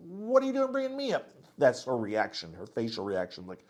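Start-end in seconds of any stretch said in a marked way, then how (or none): 0.1-1.3 much higher, a mock panicked protest; imitating Tara's startled dismay; alarmed Tara. Tara caught off guard at table.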